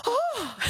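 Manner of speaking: high, exaggerated "oh"; high-pitched acted gasp of astonishment; mock startled gasp. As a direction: imitate someone taken aback. Acting out colleague's startled reaction.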